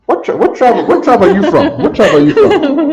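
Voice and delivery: playful mock-interrogation, pitch jumping up; teasing question. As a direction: tease her like a game-show host. Teacher jokingly quizzes student's tribe.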